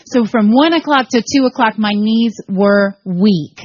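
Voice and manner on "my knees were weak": drawn out slowly, a bit shaky in feeling; weak-kneed, slow. Retold or imitated. Telling the church how nervous she was, slow and drawn out. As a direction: let each word sag, slow and spent.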